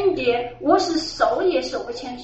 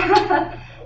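breathy laugh; 5 semitones higher than usual. Real, not acted. 说到好玩时忍不住轻声笑出来. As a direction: let out an airy little laugh.

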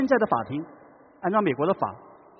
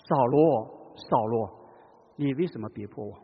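loving call; 6 semitones lower, slower than usual. Shown as a demonstration. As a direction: call him tenderly, grieving. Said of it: "是充满爱的呼唤".

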